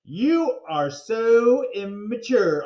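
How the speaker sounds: loud, high, slow scornful put-down; Andrea's loud, high, slow scorn; scornful scolding. Acted. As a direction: look down your nose at him.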